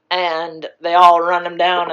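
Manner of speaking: ordinary delivery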